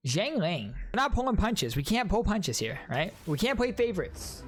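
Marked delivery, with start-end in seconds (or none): none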